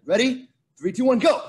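loud, high race-start countdown; energetic starter's countdown; countdown call. Real, not acted. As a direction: count them off and launch them on GO.

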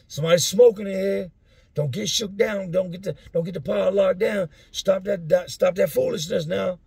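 high, loud stern guard warning; scolding guard. Retold or imitated. Guard on count warning the block about smoking, loud scolding. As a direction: tell the inmates off like a fed-up guard.

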